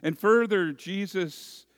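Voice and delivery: a fervent preacher driving the point home, loud and high; preaching at full force. Acted. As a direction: preach it out to the congregation. Preacher building a parable.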